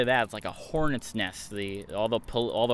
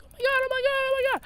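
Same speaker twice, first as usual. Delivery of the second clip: very high, panicked squeal, imitating a friend; freaking out; much faster than usual. Michaela panicking on the roadside, in a very high squeal. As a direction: mimic her shrill panic, repeating the words. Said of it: "freaking out".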